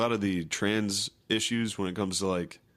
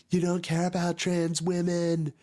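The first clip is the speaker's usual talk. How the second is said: mock shrill accusation; 10 semitones higher than usual.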